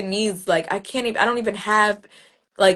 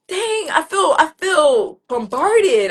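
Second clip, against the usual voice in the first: overwhelmed; 7 semitones higher, 6 dB louder, slower than usual. Retold or imitated. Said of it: "And I'm like".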